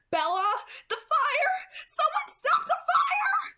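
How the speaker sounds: very high, loud, crying scream; screaming in agony. A narrator's reading. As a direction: scream as if on fire. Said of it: "I screamed as it burned me".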